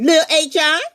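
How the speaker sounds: mama calling. Acted. Mama calls.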